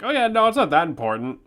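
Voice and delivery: awkward game character. Retold or imitated. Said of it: "awkwardly be like".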